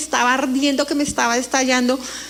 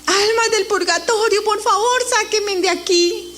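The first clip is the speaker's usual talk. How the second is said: high, desperate cry for help; horrified plea; 6 semitones higher than usual. Retold or imitated. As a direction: beg the souls in terror. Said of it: "yo gritaba horrorizada". (súplica desesperada y aguda)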